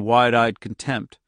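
ordinary delivery, nothing marked

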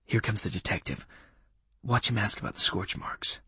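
hushed aside to an accomplice; low tone. Acted. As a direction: murmur a secret heads-up, very softly. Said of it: "in the same low tone".

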